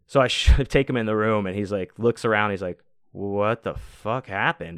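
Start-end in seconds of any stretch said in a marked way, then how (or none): 3.1-4.8 groggy friend voice. Retold or imitated. Groggy friend sees the room.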